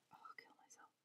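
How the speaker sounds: voiceless, very soft whisper; whispered frustrated aside. Real, not acted. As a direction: mutter it under your breath. Frustrated muttering to self.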